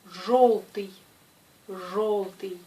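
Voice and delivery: "жёлтый" with a hard zh, first one loud; teacher models "жёлтый" with a hard zh and a plain o; correct hard zh. Shown as a demonstration. Teacher models the correct word for students, hard zh. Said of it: "ce mot se prononce".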